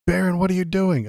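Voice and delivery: high, startled cry of discovery; high-pitched character voice. Acted. Someone catching Barron mid-kiss, high-pitched shocked character voice. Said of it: "so I know what, uh, voice to do".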